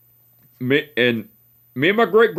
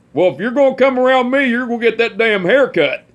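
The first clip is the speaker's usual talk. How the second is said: higher, mock-stern old man ultimatum; imitating great-grandpa; 8 semitones higher than usual. Great-grandpa warning his great-grandson to get a haircut, mock-stern higher voice. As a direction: lay down the law like a cranky old man.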